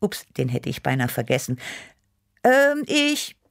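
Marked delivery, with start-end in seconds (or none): 2.4-3.4 high, drawn-out hesitation; caught out. A narrator's reading. Cat Chili scrambling for an answer, caught out. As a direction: stall on a high note while inventing.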